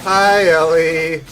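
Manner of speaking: slow, loud, high sing-song hello; drawn-out greeting. Real, not acted. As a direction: stretch the greeting out warmly. Greeting viewer in chat.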